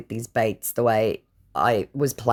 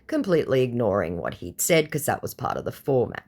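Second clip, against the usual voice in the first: ordinary talk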